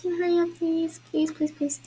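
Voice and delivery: high-pitched begging, repeated pleases; pleading. Real, not acted. Player begging a lagging friend to hurry, high pleading.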